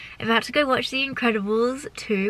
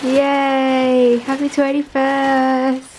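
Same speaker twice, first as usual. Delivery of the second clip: very slow, loud sing-song cheer; drawn-out cheer; 8 dB louder, much slower than usual. Real, not acted. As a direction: stretch every syllable out. Repeated birthday cheer, drawn out.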